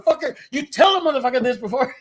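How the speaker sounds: high, loud, fast retold rebuke; exasperated scolding. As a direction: chew out your roadie in disbelief. Retold scolding of his roadie.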